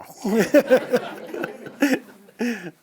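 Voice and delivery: high, loud laughing fit. Real, not acted. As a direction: burst out laughing. Laughs at end of story.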